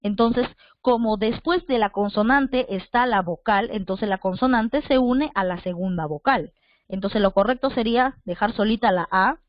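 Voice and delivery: ordinary talk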